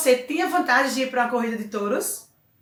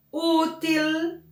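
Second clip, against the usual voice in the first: útil with a higher, held first syllable; stress on Ú; 5 semitones higher than usual. Shown as a demonstration. Teacher gives an example of graves, stressing the first syllable of útil. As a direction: lift the ú of útil. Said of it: "no u".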